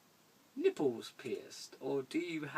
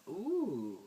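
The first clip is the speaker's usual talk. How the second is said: ordinary talk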